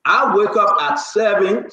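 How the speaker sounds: ordinary talk, nothing marked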